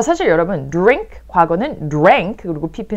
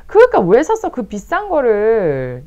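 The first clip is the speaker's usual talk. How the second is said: mock scolding; 5 semitones higher, 6 dB louder, slower than usual. Acted scolding over expensive purchase.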